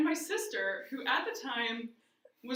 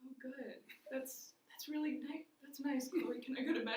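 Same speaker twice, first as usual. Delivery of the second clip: soft, tired little-sister voice; 7 dB quieter than usual. Retold or imitated. Middle-school sister getting ready for bed, tired and soft. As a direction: humour them wearily, softly. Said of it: "so tired".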